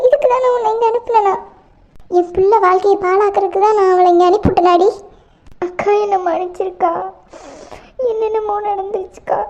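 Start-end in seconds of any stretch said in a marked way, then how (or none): 0.1-4.9 loud, raging mother's reproach; mother rages at her daughter over the wedding; furious scolding. Acted. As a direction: rail at her, full volume. Mother furious at what happened.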